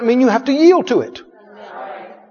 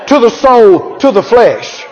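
ordinary talk, nothing marked

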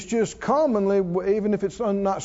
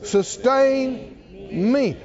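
shouted declaration; 4 semitones higher, much slower than usual. Shown as a demonstration. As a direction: ring it out as the answer. Pastor answers questions with declaration.

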